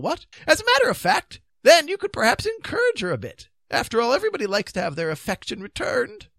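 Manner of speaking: high, hesitant, well-meaning king; reassuring king. A narrator's reading. King coaxing knights to lead the beast, reassuring. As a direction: coax them along in a high dithering voice. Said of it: "the king pointed out reassuringly".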